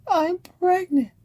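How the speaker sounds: high-pitched imitated whine; hammed-up teary voice; mock weepy confession. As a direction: play it tearful and pitiful. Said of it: "Lorraine cries".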